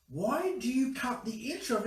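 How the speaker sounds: ordinary talk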